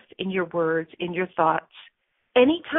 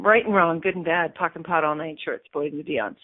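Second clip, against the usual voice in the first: low, quick incantation; clearing statement recital; 4 semitones lower than usual. Acted. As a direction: rattle it off like a spell.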